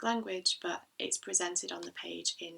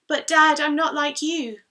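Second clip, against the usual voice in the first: high, very loud protesting call; child calling out; 9 semitones higher, 15 dB louder than usual. Acted. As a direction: cry it out to Dad across a distance.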